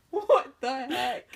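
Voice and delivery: loud, slow, disbelieving; baffled. Retold or imitated. Mom reacting to the tiny spider on the heel. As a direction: drag it out in disbelief. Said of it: "And I was like".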